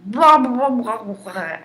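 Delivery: loud burst of blahs, tapering off; mock yelling. Woman retelling her screaming at the man, mock yell. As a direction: belt out gibberish for a screamed tirade, then let it fall. Mimics own screamed tirade.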